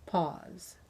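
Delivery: plain reading voice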